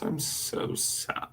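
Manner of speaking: mock sad. Mock lonely streamer.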